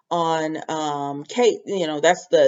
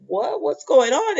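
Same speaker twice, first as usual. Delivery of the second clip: high, rising, and louder on the second line; startled protest; 9 semitones higher, 3 dB louder than usual. Retold or imitated. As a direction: caught off guard, pushing back in disbelief. Kate stunned by the accusation.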